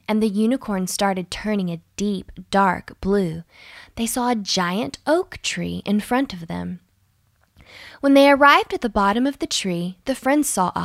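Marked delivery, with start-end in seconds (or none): none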